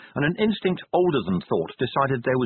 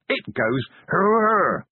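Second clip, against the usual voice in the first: slow, higher, loud bellow; savage roar; 7 semitones higher, 3 dB louder, much slower than usual. A narrator's reading. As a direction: roar the sound out slowly. Vimes roars after the explosion.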